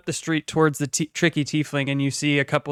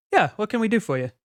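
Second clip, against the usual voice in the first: friendly townsperson; 7 semitones higher, faster than usual. Acted. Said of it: "they kinda turn".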